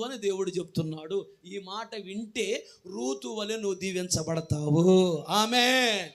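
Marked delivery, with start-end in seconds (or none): 1.4-6.1 loud, slow blessing thundered over the congregation; full-force preaching. Acted. Pastor blessing his church, slow booming preaching.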